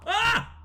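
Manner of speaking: short high-pitched yelp; sudden high cry of fright; startled yelp. Real, not acted. Player jumping at the monster, startled high yelp.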